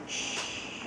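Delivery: soft whispered shush. Pretend. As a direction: hush for the sleeping bunny. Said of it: "the bunny is sleeping".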